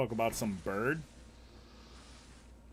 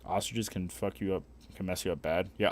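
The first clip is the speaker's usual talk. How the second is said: ordinary delivery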